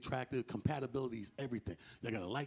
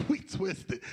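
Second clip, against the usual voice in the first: usual speaking voice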